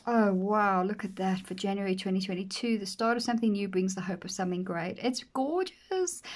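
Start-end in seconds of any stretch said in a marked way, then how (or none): 5.0-6.2 high, drawn-out swoon. Real, not acted. Slow high swoon over blue page.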